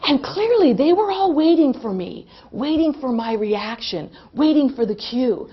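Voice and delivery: normal talking voice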